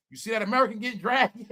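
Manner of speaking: ordinary delivery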